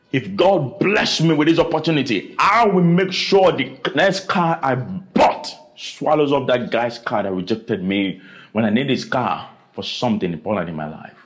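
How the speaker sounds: ordinary talk, nothing marked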